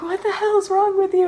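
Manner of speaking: high-pitched mock disbelief; retold exasperated sergeant. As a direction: imitate a sergeant at a loss with someone. Retold sergeant baffled by crying.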